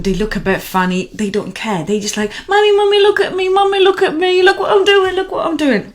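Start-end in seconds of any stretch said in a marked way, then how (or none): 2.5-5.9 put-on high, eager child voice, much higher and louder; a toddler begging for attention; child calling mommy.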